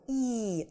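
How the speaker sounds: И held as a long ee; drawn-out vowel. Shown as a demonstration. Teacher showing how the double letter is read, long И. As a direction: stretch the И out evenly. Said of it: "Нужно немножечко потянуть".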